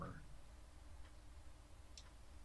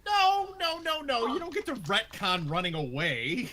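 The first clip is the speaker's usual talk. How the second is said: high, loud objection; real, forceful protest, pitched up; 11 semitones higher, 7 dB louder than usual. Real, not acted. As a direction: shout down the player's excuse. Game master protests a player's retcon.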